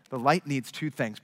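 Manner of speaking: ordinary talk, nothing marked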